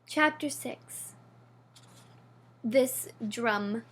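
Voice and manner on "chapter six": plain reading voice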